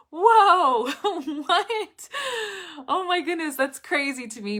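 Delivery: high, loud whoa breaking into a laugh; amused disbelief. Retold or imitated. Teacher recounting reaction to student's scam fear, amused disbelief.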